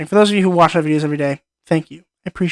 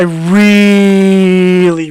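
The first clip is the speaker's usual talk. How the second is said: long drawn-out really, a bit louder; stretched-out really; 2 semitones higher, 6 dB louder than usual. Real, not acted. Reviewer gushing over a favorite movie, drawn-out really. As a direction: drag out really to gush about the film.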